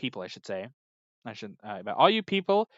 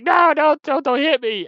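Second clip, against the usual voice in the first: mock pleading scream, much higher and louder; imitating a terrified scream; 10 semitones higher, 7 dB louder than usual. Mother behind the wall begging her husband, high frightened pleading. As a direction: cry out like a beaten mother begging. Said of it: "Kingpin mom is just screaming".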